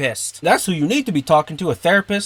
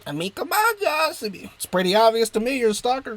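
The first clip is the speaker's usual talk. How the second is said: much higher squeaky mocking voice; 10 semitones higher than usual. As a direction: squeak it out like a taunting cartoon. Mocking voice to himself.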